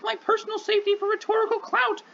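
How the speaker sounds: high, silly clown voice; mock it like a goofy clown. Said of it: "I'm going to say it with a clown voice".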